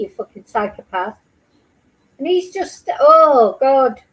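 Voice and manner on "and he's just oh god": loud, strained oh God; exasperated cry. Real, not acted. Viewer reacting to the abuse story, with a loud anguished cry. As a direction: let the outrage burst out.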